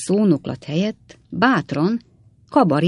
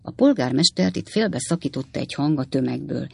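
ordinary delivery, nothing marked

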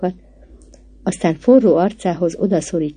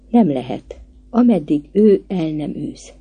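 ordinary delivery, nothing marked

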